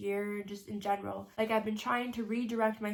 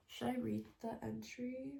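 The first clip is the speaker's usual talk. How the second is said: normal talking voice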